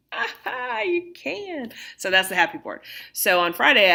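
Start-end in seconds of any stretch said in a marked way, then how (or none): 0.1-1.7 high, bright "aha" of discovery; pleased surprise. Real, not acted. Teacher discovering the camera shows her board, surprised. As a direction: react as if you just found it works.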